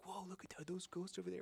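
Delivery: hammed-up awed tourist; mock tourist. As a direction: send up the gullible tourists. Mocking naive tourists' reaction.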